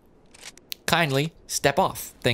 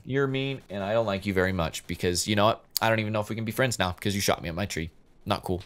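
pouty quiet sulk; 4 semitones lower, 7 dB quieter than usual. Pretend. Sulking at shooter.

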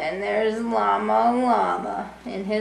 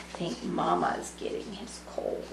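hushed whisper, a little fast; 4 semitones lower, 9 dB quieter than usual. Real, not acted. Secret aside to the kids.

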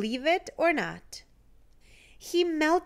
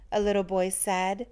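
plain reading voice

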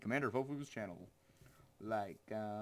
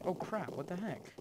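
startled; 8 semitones higher, 3 dB louder than usual. Real, not acted. Startled by where the game put him.

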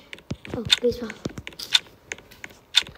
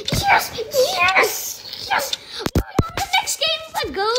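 high, cheering yes of relief; excited cheering; 13 semitones higher, slower than usual. Real, not acted. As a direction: celebrate making it through. Qualifies for next game.

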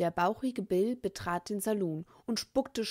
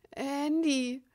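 higher, frightened answer; teeth chattering; 9 semitones higher than usual. A narrator's reading. The scared boy giving his name, teeth chattering. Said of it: "mit klappernden Zähnen".

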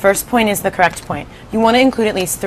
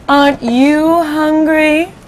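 very slow, higher sinister spider; creepy spider; 8 semitones higher, much slower than usual. Acted. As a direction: stretch it out like a spider eyeing prey. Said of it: "she said suddenly".

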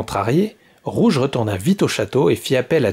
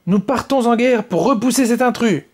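very high, louder battle command; shrill rallying order; call to arms; 11 semitones higher, 3 dB louder than usual. Acted. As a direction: rouse the soldiers for war. Queen rallies soldiers to war.

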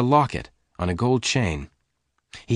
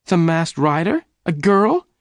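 high, rising incredulous questions; astonished cry; 9 semitones higher, 5 dB louder than usual. A narrator's reading. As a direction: cry out in high-pitched disbelief. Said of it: "Jane cried".

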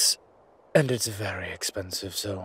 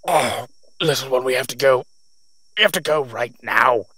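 strained and much louder, fighting for air; choking urgency; 2 semitones higher, 9 dB louder than usual. Acted. Urging the little one to flee while choking on gas. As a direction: force the words out through a burning throat.

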